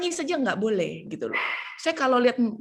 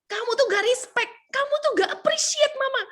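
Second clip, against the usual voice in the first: high-pitched mock offended mom; indignant mother; 13 semitones higher than usual. Mocking a mother who scolds her child for disrespect, high and indignant. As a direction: play the wounded mother, pitched up. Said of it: "mama-mama itu pasti cara berpikirnya gini".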